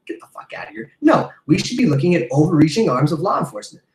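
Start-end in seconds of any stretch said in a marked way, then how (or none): 0.1-0.9 dismissive whisper, voice gone to breath. Real, not acted. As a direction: hiss it under your breath in disgust. Scoffing dismissal in rant.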